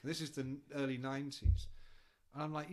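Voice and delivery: ordinary talk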